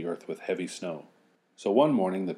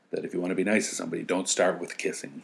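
fast; faster than usual. Real, not acted. Fast aside.

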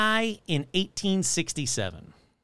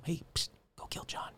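sly breathy whisper urging murder; 15 dB quieter than usual. Retold or imitated. The inner voice whispering Henry into murder. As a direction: lean in and whisper it like a secret temptation. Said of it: "Like that".

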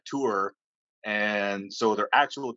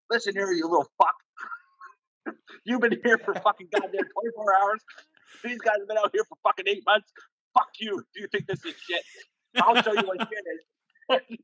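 much higher, hammed-up angry rant; mock chief chewing out; 12 semitones higher than usual. Chief berates the new sailor who complains, mock angry rant. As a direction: play a chief tearing into a whiny recruit. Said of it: "He's like".

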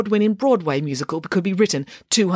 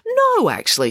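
brisk correction; 4 dB louder than usual. Acted. Nanny Piggins correcting.

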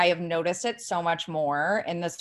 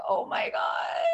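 exasperated whisper; 7 semitones higher, 8 dB quieter, slower than usual. Retold or imitated. Retold reaction to a mean comment.

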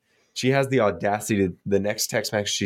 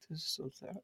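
breathy whisper through a laugh; 7 semitones higher, 21 dB quieter than usual. Retold or imitated. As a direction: whisper it to yourself, half laughing. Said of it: "whis-, she's like".

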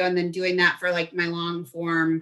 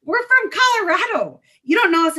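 very high-pitched, louder mock disbelief; incredulous protest; 12 semitones higher, 5 dB louder than usual. Insisting to a bouncer they're from Colorado, incredulous. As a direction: play up the baffled out-of-towner.

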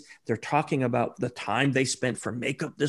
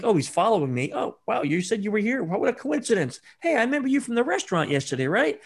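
higher, eager fake-coincidence chatter, rushing the recall; feigned surprise; 7 semitones higher than usual. Shown as a demonstration. Social engineer faking a chance meeting at Starbucks, bright and eager. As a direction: act delighted by a lucky run-in.

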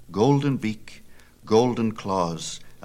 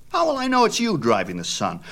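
put-on high, quick bragging boy's voice; a show-off kid bragging about his dad; higher boy voice, boasting; boastful boy Epaphus; 13 semitones higher, 4 dB louder than usual. A narrator's reading. Epaphus challenges Phaethon.

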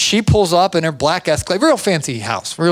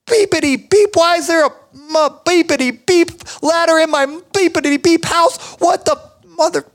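cursing rant; 12 semitones higher than usual. Retold or imitated. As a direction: rage at the ladder in your house. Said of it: "says every cuss word in the book".

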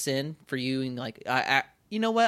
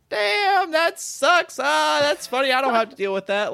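much higher and louder, breezy don't-care voice; carefree shrug; 13 semitones higher, 7 dB louder than usual. Retold or imitated. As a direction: toss it off like nothing matters. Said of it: "I'm really lighthearted".

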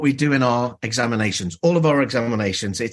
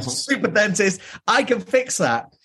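mock superhero dentist; 7 semitones higher than usual. Mock hero dentist boast.

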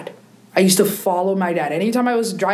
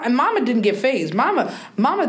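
ordinary talk

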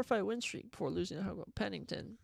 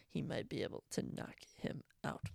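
whispered warning; whispered upset pick; 5 semitones lower, 8 dB quieter than usual. Acted. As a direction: breathe it like a secret. Quiet prediction aside.

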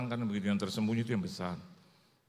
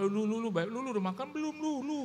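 much higher-pitched imitation of a chatty friend; teman Chinese; 11 semitones higher than usual. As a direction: tirukan teman yang menyapa dengan nada sangat tinggi. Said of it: "Ada yang begitu, Saudara". (tiruan teman Tionghoa bernada melengking tinggi)

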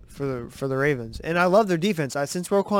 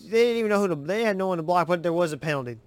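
ordinary talk

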